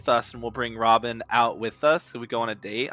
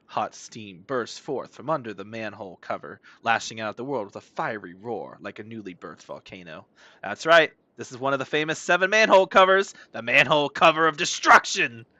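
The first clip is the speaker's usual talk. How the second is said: dramatic announcer; 4 dB louder than usual. Acted. Streamer reading manhole cover lore aloud, dramatic announcer. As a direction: build from hushed drama to a big announcement.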